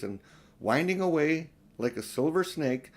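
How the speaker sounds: ordinary delivery, nothing marked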